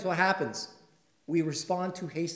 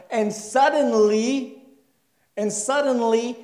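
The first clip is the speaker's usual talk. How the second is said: slow dramatic build; 8 semitones higher, 8 dB louder, slower than usual. Shown as a demonstration. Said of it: "repeated for drama".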